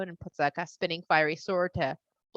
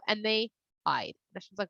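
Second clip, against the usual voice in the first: ordinary delivery, nothing marked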